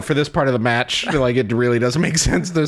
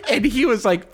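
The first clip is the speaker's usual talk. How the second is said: ordinary delivery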